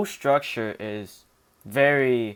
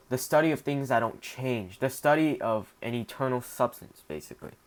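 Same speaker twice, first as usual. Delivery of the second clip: ordinary talk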